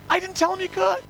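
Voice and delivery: high, loud imitation of a defensive relative; mock defensive reply. Brother-in-law answering the panicked visitor, mock defensive. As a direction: deny the blame in a high voice.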